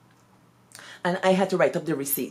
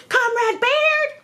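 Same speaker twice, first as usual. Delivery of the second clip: much higher, louder, drawn-out call of the name; imitating a shout; 6 dB louder, slower than usual. Headmistress yelling for the young teacher across the yard, mock loud shout. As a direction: bellow it across the schoolyard like a cross headmistress. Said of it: "Miss Hamer started shouting".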